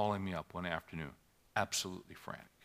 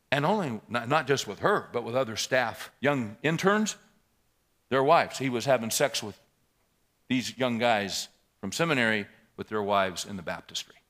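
loud, raised preacher's outrage; indignant preaching; 4 semitones higher, 12 dB louder than usual. Acted. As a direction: thunder it at the congregation. Preaching builds again in outrage.

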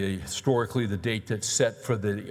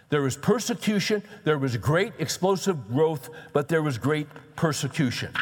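full-force preaching; 5 semitones higher than usual. Acted. Preacher stirring his congregation about persecution, fervent preaching.

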